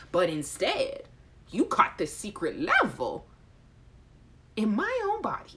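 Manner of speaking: ordinary delivery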